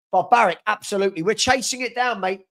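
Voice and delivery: ordinary talk, nothing marked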